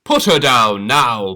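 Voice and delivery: loud booming command; bellow. Acted. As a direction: woodcutter threatening. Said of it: "bellowed the woodcutter".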